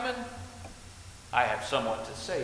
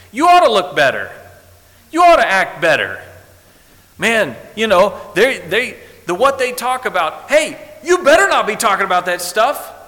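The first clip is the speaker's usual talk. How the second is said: loud, higher impassioned preaching; preaching at full force; 8 semitones higher, 14 dB louder than usual. Acted. Preacher scolding the church, loud and impassioned. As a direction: drive it home to the congregation.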